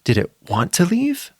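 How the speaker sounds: ordinary delivery, nothing marked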